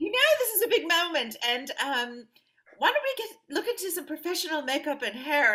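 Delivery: a rough imitation of an Australian friend; mock Australian voice, lightly done; attempted Australian accent. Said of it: "I can't even do her accent".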